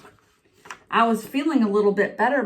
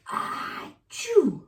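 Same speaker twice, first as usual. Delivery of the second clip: big sneeze; 7 semitones higher, 3 dB quieter than usual. Acted. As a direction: sneeze it out grandly. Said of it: "I sneezed a great sneeze".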